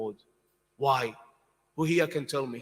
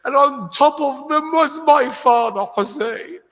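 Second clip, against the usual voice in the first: higher, louder, slowing grief-stricken wail; anguished lament; 12 semitones higher, 7 dB louder, slower than usual. Acted. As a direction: cry out the Imam's loss like a breaking mourner. Imam's lament, rising wailing voice.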